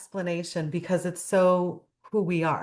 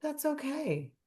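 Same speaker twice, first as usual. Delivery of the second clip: gentle Sadness; 5 semitones higher, 8 dB quieter than usual. Retold or imitated. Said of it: "she says".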